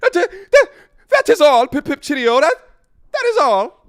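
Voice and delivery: loud, very high mock-British voice; hammed-up British sign-off; terrible British accent. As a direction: put on a silly, high-pitched British accent. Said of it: "That was a terrible accent".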